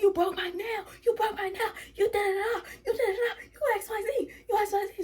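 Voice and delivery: high, soft whiny complaint with laughter; mocking whiny girl. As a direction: mimic her whining about her nail, laughing. Voicing girl complaining about nail.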